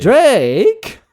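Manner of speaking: put-on loud high [name] call; [name] impression. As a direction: yell [name] like [name]. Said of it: "That's just how he said it".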